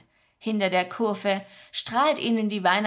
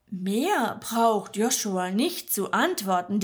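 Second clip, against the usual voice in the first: ordinary delivery, nothing marked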